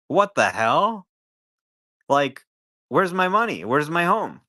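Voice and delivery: high, loud, indignant demand for the money back; outraged buyer. Retold or imitated. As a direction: play a homeowner who just learned his money is gone. Cheated buyer confronting bankrupt developer.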